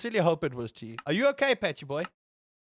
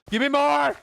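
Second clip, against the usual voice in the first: high, loud demand; mock demanding shout; 7 semitones higher, 6 dB louder than usual. Streamer pressing chat for things to blame, shouted. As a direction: yell for more, playfully insistent.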